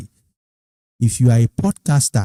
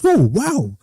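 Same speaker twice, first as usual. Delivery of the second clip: impressed; 8 semitones higher than usual. Retold or imitated. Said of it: "he said".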